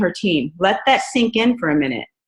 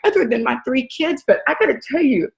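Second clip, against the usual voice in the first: normal talking voice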